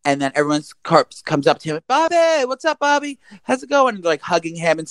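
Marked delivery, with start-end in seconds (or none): 1.9-4.0 high, gushing buddy voice; imitating thrilled college friends; mock excited greeting. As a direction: greet a long-lost pal with delight. Students greet mistaken twin.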